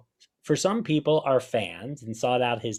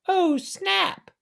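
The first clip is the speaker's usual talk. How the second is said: high, mocking "Oh, snap"; imitating the snap guy; 4 dB louder than usual. Host mocking the enthusiastic guy in the video, high mock squeal. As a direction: squeal it like the overexcited fan. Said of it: "the guy that cried".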